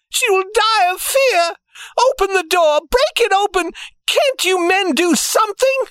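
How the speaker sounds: very high, frantic mother's voice; panicked cry. A narrator's reading. Mother begging the men to free her child, frantic cry. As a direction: plead frantically, pitch way up. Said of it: "her mother cried".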